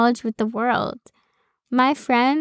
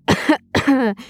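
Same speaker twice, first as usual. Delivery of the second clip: short double cough as an aside; a dry comic ahem-cough; pointed cough. Acted. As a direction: cough knowingly at your own claim. Pointed cough after perfection claim.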